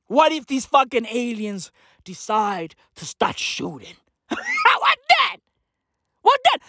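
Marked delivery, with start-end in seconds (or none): 4.3-6.6 high panicky laugh. Acted.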